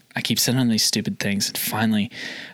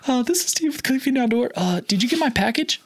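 imitated high-pitched sales greeting; high nervous voice; 13 semitones higher than usual. Salesman retelling his follow-up call about a package, high-pitched. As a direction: replay your own voice gone too high. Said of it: "I'm sure my voice got, like, so high".

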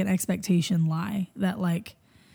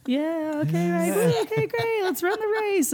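half-hearted cheer; 11 semitones higher than usual. Retold or imitated. Crowd's awkward half-hearted cheering.

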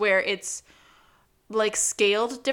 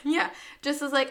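normal talking voice

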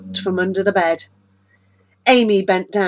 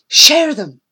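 firm; 7 semitones higher, 8 dB louder than usual. Acted. Amy tells the boys.

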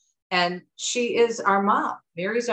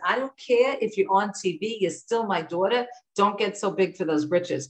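imitating mom's Jersey accent, dropped Rs; 3 semitones higher than usual. Mom to daughter on television, scolding in Jersey accent. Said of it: "And she told me that".

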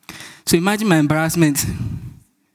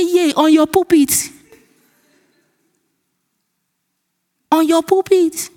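high-pitched incredulous exclamation; retold shock, voice jumping up high; astonished disbelief; 10 semitones higher than usual. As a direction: marvel at it in a high, amazed voice. Retelling astonishment at pastor's invitation.

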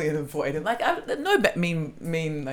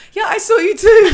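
high, loud, over-eager shout; hammed-up shouted confession; mock shouted admission; 13 dB louder than usual. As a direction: blurt it out loudly, pitched way up. Retold awkward admission to the date.

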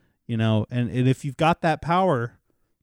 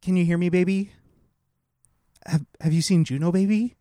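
put-on cooing to baby bump. Quoting movie, cooing to baby.

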